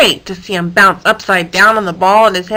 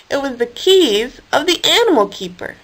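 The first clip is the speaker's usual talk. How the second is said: plain reading voice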